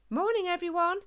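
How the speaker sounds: high, loud hearty call; loud shout. A narrator's reading. Human greets the crowd in the park, shouting loudly. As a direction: call out brightly to a whole crowd. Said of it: "a human shouted loudly".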